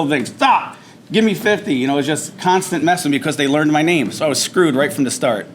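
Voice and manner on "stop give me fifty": barked order. Retold or imitated. Drill instructor orders fifty push-ups, barking. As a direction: bark the command.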